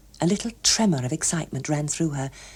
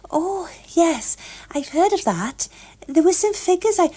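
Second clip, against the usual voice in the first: put-on higher, eager girlish voice; a young woman trying to sound poised; lightly voiced eager Gloria; 12 semitones higher than usual.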